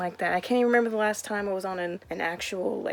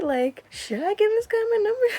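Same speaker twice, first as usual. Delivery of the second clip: panicked; 13 semitones higher, 4 dB louder than usual. Retold or imitated. Panicked self-doubt.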